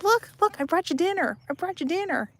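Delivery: a high cooing pet voice; high mock voice. Owner offering dinner to the dog, in a high playful voice. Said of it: "She was like".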